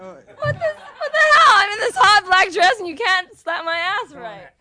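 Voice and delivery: very high, loud fake indignation; mock outrage. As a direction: squeal in playful mock offense. Mock outrage at weak slap.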